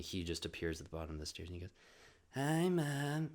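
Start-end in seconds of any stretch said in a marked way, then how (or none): 2.3-3.3 imitating a husky little boy; higher, husky kiddie imitation. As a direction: greet mom in a put-on husky kid voice. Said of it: "he has a really husky voice".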